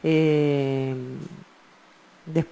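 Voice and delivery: ordinary talk, nothing marked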